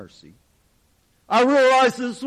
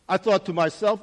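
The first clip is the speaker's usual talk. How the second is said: ordinary delivery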